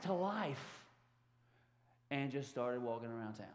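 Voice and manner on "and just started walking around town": ordinary delivery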